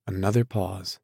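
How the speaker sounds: plain reading voice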